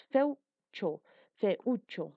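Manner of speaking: "Feucho" broken into fe-ú-cho; separated syllables. Shown as a demonstration. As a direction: pause between the parts of "Feucho". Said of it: "Tres pronunciaciones o intensidades".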